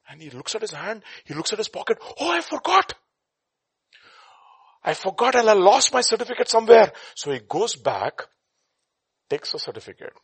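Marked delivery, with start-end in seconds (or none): 2.2-6.9 high, loud cry of dismay; alarmed realization. Acted. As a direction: cry out at a sudden loss.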